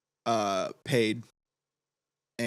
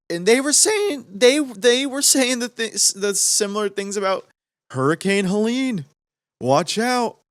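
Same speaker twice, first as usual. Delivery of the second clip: higher, mock alarmed hype voice; 8 semitones higher than usual. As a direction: hype it up like a scare story. Mocking hype about Helene.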